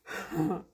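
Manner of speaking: a sudden high laugh; high bright laugh; burst of laughter. Real, not acted. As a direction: laugh out at the gross discovery. Laughing at melted treats.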